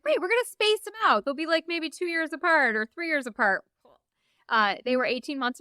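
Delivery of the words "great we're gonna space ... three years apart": high, bright naive optimism; mock cheerful. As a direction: play up the overconfident planning. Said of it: "We're like".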